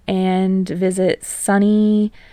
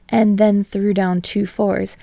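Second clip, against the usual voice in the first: ordinary delivery, nothing marked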